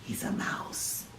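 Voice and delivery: hushed aside to the class; whispered aside. Real, not acted. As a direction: lean in and whisper it.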